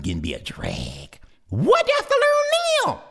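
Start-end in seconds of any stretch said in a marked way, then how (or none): 1.5-3.0 high, fast complaining squeak; high exasperated chick; whiny little brother. Acted. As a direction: whine impatiently. Baby hawk whines at sister.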